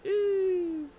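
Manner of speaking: very high, loud squeal of Ew; disgusted ew. Retold or imitated. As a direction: squeal it in a falsetto. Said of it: "Reginald's like".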